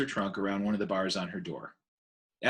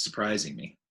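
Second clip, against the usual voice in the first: ordinary delivery, nothing marked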